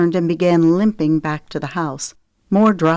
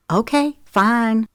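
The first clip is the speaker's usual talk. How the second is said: slow, higher grudging concession; huffy; 6 semitones higher, slower than usual. A narrator's reading. Zoe giving in to Daddy, huffy. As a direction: give in with a huff. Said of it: "Zoe huffed".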